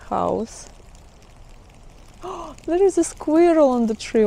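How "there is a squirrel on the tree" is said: gasp, then a higher, excited call; sudden delighted discovery after a gasp; real surprise. Real, not acted. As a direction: catch sight of it suddenly and point it out. Spots squirrel with gasp.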